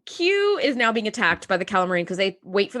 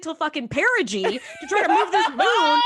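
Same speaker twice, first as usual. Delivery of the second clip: laughing, rising higher to a squeak; laughing rant; 4 semitones higher than usual. Real, not acted. Podcaster mocking Geordi's timing, laughing. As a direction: giggle through the complaint.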